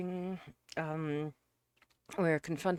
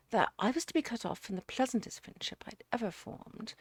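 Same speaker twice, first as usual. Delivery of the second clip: higher, louder, emphatic character dialogue; earnest suitor defending himself; 6 semitones higher, 5 dB louder than usual. Acted. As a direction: plead your case as the aggrieved suitor. Narrator voices Jerry's self-defence.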